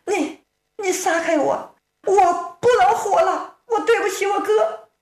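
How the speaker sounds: lightly voiced high distraught young woman; pulling away, tearful and high; distraught girl. Girl pleading to be let go so she can die, high and tearful.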